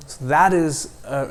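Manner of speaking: ordinary delivery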